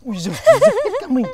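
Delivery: high, loud startled cry. Acted. Servant startled by the girl's sudden call. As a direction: jump in fright.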